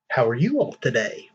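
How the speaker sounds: usual speaking voice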